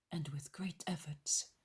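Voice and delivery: ordinary delivery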